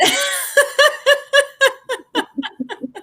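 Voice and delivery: high, long laugh that falls away; real laughter. Real, not acted. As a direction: let the laugh roll out. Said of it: "laughing at the smile challenge".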